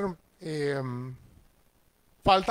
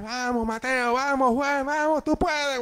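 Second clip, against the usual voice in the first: cheering shout; 8 semitones higher than usual. Retold or imitated. Father cheering his sons at their match, shouting high. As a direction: yell encouragement from the stands. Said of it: "para gritar".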